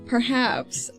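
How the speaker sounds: ordinary talk, nothing marked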